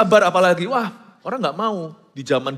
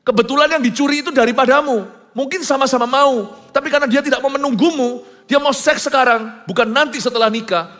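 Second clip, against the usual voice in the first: loud, high, forceful warning to the congregation; urgent raised warning from the pulpit; fiery preaching; 6 semitones higher, 5 dB louder than usual. Acted. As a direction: preach it hard, full force. Preacher rising to full force.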